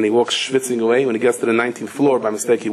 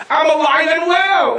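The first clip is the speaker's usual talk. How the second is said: much higher, louder and slow, in falsetto; mock woman's voice; 5 dB louder, slower than usual. Woman announcing to her father-in-law, in high falsetto. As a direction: lift into a high put-on woman's voice, drawn out. Woman revealing she survived.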